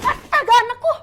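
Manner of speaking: high, loud panicked cry; a mother's horrified scream for her child; mother seeing her daughter in danger, crying out in panic. Acted.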